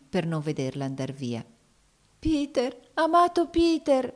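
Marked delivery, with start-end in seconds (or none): 2.2-4.1 very high, plaintive farewell cry; weeping girl, voice pitched far up; tearful. A narrator's reading. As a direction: call out the name as if in tears. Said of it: "esclamò essa in pianto".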